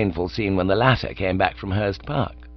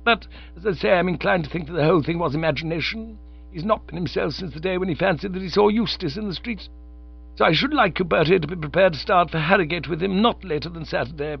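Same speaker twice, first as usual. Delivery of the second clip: a lightly voiced stern, formal aunt; Aunt Agatha's voice; 8 semitones higher than usual. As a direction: read it as a prim aunt laying down the law. Aunt Agatha explains the burglary.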